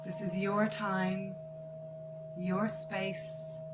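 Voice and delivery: slow meditation voice with long pauses; guided meditation. Acted. Artist leads listeners into noticing their breath, guided meditation. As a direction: guide them gently into relaxation, phrase by phrase.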